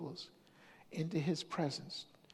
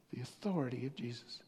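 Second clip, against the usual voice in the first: whisper; 5 semitones lower, 5 dB quieter than usual. Acted. Sermon point, hushed.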